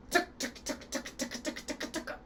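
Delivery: crisp unvoiced tuk tuk tuk; tuk tuk clicks. Shown as a demonstration. Coach leads call and response with unvoiced tuk clicks. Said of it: "call and response vocal warm-up".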